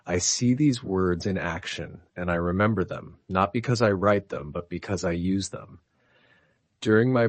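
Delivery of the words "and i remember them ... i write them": ordinary delivery, nothing marked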